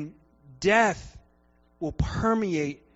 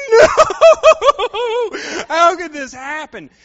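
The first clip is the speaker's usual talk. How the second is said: mock anguish; 11 semitones higher, 11 dB louder than usual. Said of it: "he's like".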